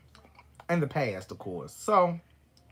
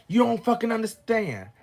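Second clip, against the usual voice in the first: put-on raised, louder voice of an upset boyfriend; angry retort; 7 semitones higher, 5 dB louder than usual. Drunk boyfriend refusing to talk it over, angry retort. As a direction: push it out higher and frustrated. Retold quarrel, ex snapping back.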